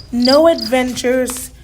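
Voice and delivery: ordinary delivery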